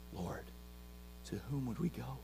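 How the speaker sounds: soft, low-voiced reverent quote; Peter's words to Jesus, said hushed and reverent; hushed scripture reading. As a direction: read it gently, almost a prayer.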